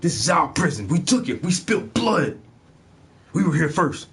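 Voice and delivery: high-pitched, impassioned character rant; acted indignant outburst. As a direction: protest like an outraged survivor. Acts out an angry show speech.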